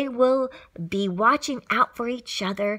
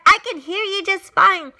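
high, raised reply; calling back; 7 semitones higher, 4 dB louder than usual. A narrator's reading. Horton answering the mayor over the racket, calling back high. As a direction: call the answer back brightly pitched. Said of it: "Horton called back".

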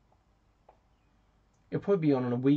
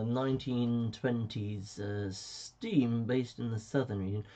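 slow careful listing; slower than usual. Retold or imitated. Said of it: "You don't have to say".

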